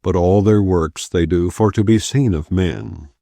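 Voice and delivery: ordinary delivery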